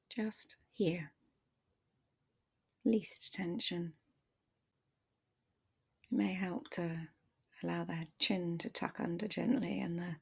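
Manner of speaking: guided meditation. Acted. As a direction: ease the listener into their body, gently. Leads a guided body meditation.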